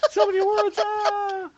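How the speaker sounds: overexcited squeal; high squeal. Real, not acted. Squealing at awards.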